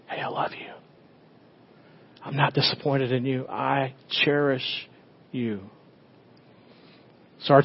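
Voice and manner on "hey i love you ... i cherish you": very soft, hushed reassurance, slowing at the end; tender near-whisper. Acted. God the Father reassuring his child, tender near-whisper.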